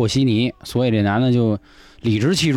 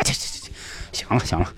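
quiet brush-off; 4 semitones lower, 7 dB quieter than usual. Retold or imitated. 警察敷衍劝停.

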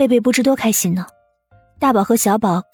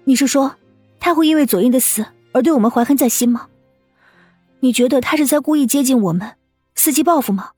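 higher, worried woman's questions; anxious, flustered; 6 semitones higher than usual. Acted. Wife asking husband if the boy wants revenge, anxious. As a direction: press him with rising alarm. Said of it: "顿时急了".

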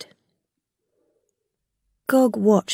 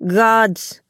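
sharp louder call to the guards; 4 dB louder than usual. Acted. Gog summoning guards to take Rilla away. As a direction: summon them with one barked word.

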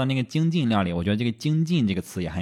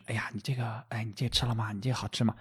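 hushed, softer re-enacted chat; imitating instinctively lowered voices; 8 dB quieter, faster than usual. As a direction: keep your voice down, as if not to disturb. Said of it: "本能的压低声音".

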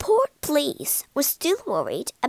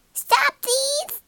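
parrot squawk; 14 semitones higher than usual. Acted. Parrot squawks stop thief.

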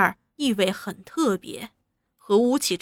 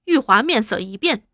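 ordinary delivery

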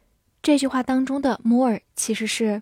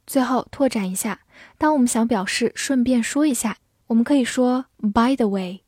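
ordinary talk

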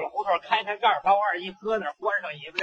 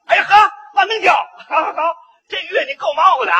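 hearty shouted greeting; 4 semitones higher, 7 dB louder than usual. Acted. Driver's hearty greeting acted out.

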